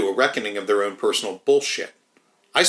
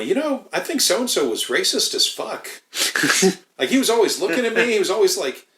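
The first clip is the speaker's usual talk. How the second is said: much higher, animated retelling voice; higher-voiced imitation of an employee gossiping; imitating a worker; 10 semitones higher than usual. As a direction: mimic a coworker complaining, pitched way up. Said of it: "they'd tell me".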